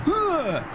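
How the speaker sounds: high yelled "ugh" that falls away; exasperated high groan; frustrated groaning yell. Real, not acted. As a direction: cry out in real frustration. Frustrated cry at the game.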